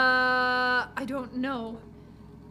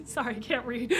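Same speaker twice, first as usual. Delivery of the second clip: ordinary talk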